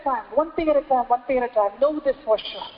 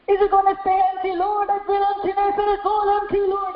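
higher, complaining wail to the Lord; preacher mimicking a complaining believer, mock lament; mock whiny lament; 6 semitones higher than usual. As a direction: whine it like a sulking believer. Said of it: "I'm gonna cry over the emptiness".

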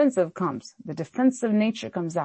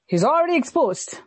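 ordinary talk, nothing marked

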